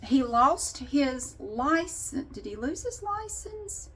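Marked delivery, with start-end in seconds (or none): 2.3-3.9 high, soft aside to herself; musing to self. Real, not acted. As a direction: ask it quietly, half to yourself. Wondering aloud to herself mid-story.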